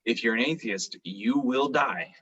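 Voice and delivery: ordinary delivery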